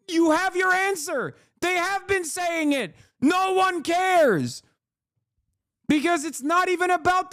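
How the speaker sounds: high, heated rant at chat; real frustration pushed up high; yelling at chat. Real, not acted. Yelling at a chatter over Iran, pitched high and heated. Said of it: "I apologize for yelling at you".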